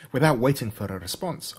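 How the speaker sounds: plain reading voice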